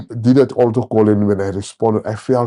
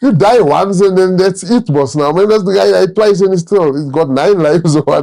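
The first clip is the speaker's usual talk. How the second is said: higher, louder comic incredulity, breaking into a laugh; mock disbelief; 8 semitones higher, 4 dB louder than usual. Man joking to listener about a twice-dead speaker, mock disbelief. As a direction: play up the baffled outrage for laughs.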